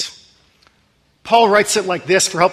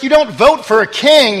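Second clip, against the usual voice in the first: indignant king's retort; 5 semitones higher, 8 dB louder than usual. Retold or imitated. King Arthur retorting in retold film.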